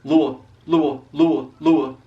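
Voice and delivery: first part of "Louisville" repeated as LOO-uh; broken-down demo. Shown as a demonstration. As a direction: drill "Louis-" as LOO-uh, S silent. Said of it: "taking three syllables and put 'em in one word".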